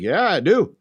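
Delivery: playful, swooping yeah; teasing. Retold or imitated. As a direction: wind him up with a drawn-out answer. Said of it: "he's just messing with him".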